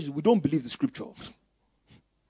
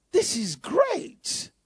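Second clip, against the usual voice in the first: slow, higher, marvelling delight; delighted lift; 10 semitones higher, much slower than usual. Acted. Savouring the verse twice.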